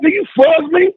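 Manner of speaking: retold heated outburst; incredulous angry retort.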